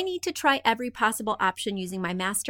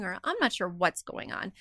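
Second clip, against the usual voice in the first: ordinary talk, nothing marked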